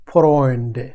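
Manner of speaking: ফ্রয়েন্ডে said clearly with the অয় vowel; German word demo. Shown as a demonstration. Teacher showing Bengali learners the German word, careful demo. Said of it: "এখানে কিন্তু ওই উচ্চারণ কিন্তু ওই আইসা পড়ে".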